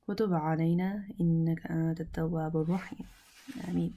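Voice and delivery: brisk murmured Arabic supplication; prayer. Acted. Teacher opening the class with a quick dua. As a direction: rattle through a familiar dua, quickly.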